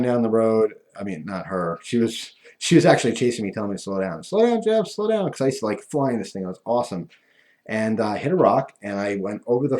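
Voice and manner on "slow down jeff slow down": high-pitched grandma call; grandmother calling out. Retold or imitated. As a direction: call after a speeding kid, pitched high. Grandmother chasing, calling after him.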